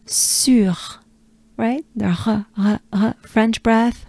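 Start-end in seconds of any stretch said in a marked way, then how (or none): 0.1-0.8 sur with rounded U and breathed r; French sur. Shown as a demonstration. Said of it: "we are looking for". 2.3-3.2 r as a puff from the throat; short r sounds made of breath; rasped uvular r, repeated; breathed French R. Shown as a demonstration. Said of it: "French R is a French breath".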